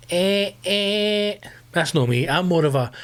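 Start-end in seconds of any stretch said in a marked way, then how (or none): 0.1-1.4 short high honks; imitated car horn. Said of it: "sits on the horn".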